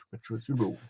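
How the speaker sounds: ordinary talk, nothing marked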